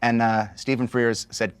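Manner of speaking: ordinary talk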